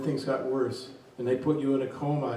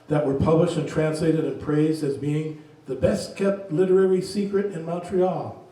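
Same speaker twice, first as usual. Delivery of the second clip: usual speaking voice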